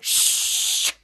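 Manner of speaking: drawn-out unvoiced shh; steady, sustained hushing sound; long shushing. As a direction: hush everyone with a long hiss of air. Piper hushes the others.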